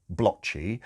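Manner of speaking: ordinary delivery, nothing marked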